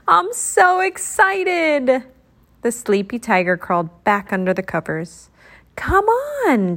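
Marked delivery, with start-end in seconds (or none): 0.1-2.0 high, stretched-out excitement; excited. A narrator's reading. Character declares her excitement in story. 5.8-6.8 high, coaxing insistence; insistent. A narrator's reading. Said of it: "Shine insisted".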